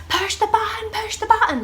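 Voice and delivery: high, loud retold panic; panicking midwife. Midwife to Danny about the alarm, high panicked yell. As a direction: imitate a frantic, squealed order. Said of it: "She starts panicking then".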